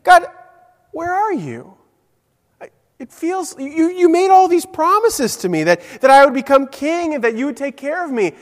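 high, pleading prayer of a hurt king; lamenting David. Acted. David pleading with God, hurt and high, anguished lament. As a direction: cry out to God like a hurt king who feels abandoned. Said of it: "And he's saying".

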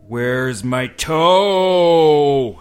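very slow, loud, high drawn-out wail; groaning monster. A narrator's reading. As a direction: hold toe long like a ghost at the door. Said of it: "he heard the voice".

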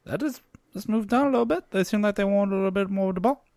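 high, loud little gnome voice. Acted. Gnome explaining he moved down the bar, high-pitched. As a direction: squeak it out as a small gnome.

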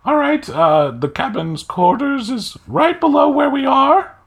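loud, very high squeaky officer; fairy commodore. Acted. Fairy commodore directs guests to the cabin, high squeaky voice. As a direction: give directions in a tiny piercing fairy voice. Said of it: "And she goes".

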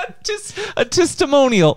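high laughing correction. Real, not acted. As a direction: laugh through the word. Laughing at own word.

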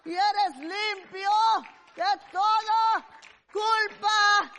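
loud, slow, shouted sermon with cries of aleluya; fiery preaching. Acted. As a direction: preach it out at full force to the congregation. Preaching at full force.

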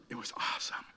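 awestruck whisper; hushed awe. Retold or imitated. Preacher telling kids of his childhood leap, awed whisper. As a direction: drop to a breathless whisper.

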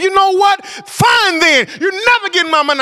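screamed retort. Retold or imitated. Preacher retelling yelling at the collector, furious shout. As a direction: yell it and slam the phone down. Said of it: "I screamed".

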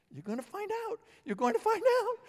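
rising shouted warning to the passengers. Pretend. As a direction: call it out as an urgent warning. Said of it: "And you're gonna say".

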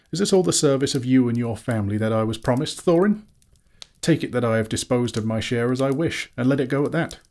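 high-pitched, aggrieved questioning; indignant hobbit Bilbo. A narrator's reading. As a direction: protest hurt and loud, jumping up at the name. Bilbo protests.

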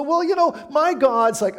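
high, loud mock whine of a smug believer; hammed-up self-righteous voice; mock churchgoer. Pastor mimics a self-justifying churchgoer, high and loud.

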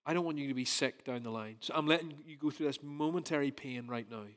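soft, quiet, reassuring; calm a crying baby; gentle father reassurance. Retold or imitated. Father soothing his baby son at the jab, softly reassuring. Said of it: "I'm saying".

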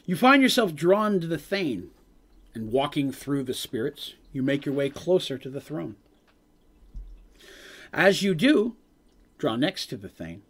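measured storytelling voice; game master narration. A narrator's reading. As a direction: set the scene like a dungeon master. GM scene narration.

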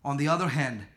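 low, soft, measured reading of the verse; quiet scripture reading. Real, not acted.

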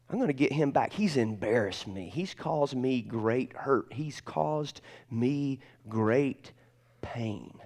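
soft, slow, dwelling murmur; brooding inner voice. Retold or imitated. Young man nursing his grudge against Jeff, softly and slowly. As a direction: brood over each word quietly. Voicing old grudge thoughts.

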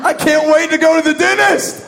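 high, loud excitement; mock glee. Pastor now eager for nitrous at the dentist, loud glee. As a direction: burst out with over-eager joy.

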